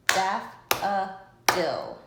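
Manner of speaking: "Daffodil" slow and loud, three separate beats; clapped syllables. Shown as a demonstration. Teacher leading class clapping daffodil, slow and loud. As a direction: drag out "Daffodil" beat by beat. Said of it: "let's clap together to count the beats".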